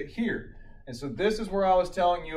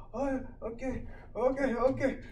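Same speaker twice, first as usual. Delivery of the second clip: higher-pitched, imitated anxious compliance; meekly agreeing patient; 10 semitones higher than usual. Patient agreeing to be shocked, meekly compliant. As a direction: play the patient going along, voice raised.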